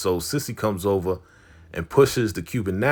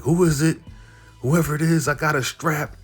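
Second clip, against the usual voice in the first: paranoid threat; 6 semitones higher, faster than usual. Retold or imitated.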